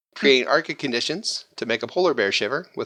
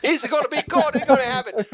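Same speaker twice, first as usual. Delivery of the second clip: high, hammed-up Italian accent; laughing mock-Italian; 9 semitones higher than usual. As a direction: ham it up as a high-pitched comic Italian. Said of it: "That was my Italian accent".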